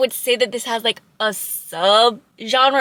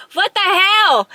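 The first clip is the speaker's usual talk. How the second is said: higher, louder what the hell; startled exclamation; 9 semitones higher, 5 dB louder, slower than usual. Real, not acted. Reviewer surprised at herself for tearing up, exclaiming. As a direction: catch yourself off guard, surprised at your own tears. Reacting to own tears.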